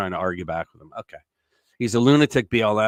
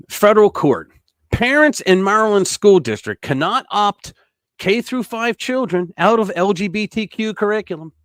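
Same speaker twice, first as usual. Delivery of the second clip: loud, high, proclaimed news headline; headline announcement; 8 semitones higher, 6 dB louder than usual. Real, not acted. Announces the next headline loudly.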